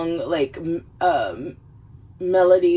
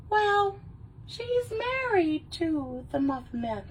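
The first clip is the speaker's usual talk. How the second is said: high squeaky gingerbread voice; 10 semitones higher, 6 dB quieter, slower than usual. Retold or imitated. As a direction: pitch way up, slow and squeaky. Gingerbread Man punchline.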